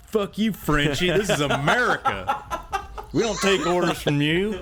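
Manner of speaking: put-on high, slow, jeering Minnesota accent that slides Southern; bad Minnesota accent. DM voicing angry partygoers to the robot, bad Minnesota accent. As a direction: sneer it like a bad Minnesota local. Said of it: "That was a bad Minnesota accent".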